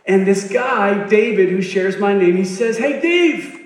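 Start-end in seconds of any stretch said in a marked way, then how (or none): 2.8-3.6 high-pitched mock yell across a noisy room; hammed-up shouting to a friend; imitated yelling. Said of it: "So he's yelling".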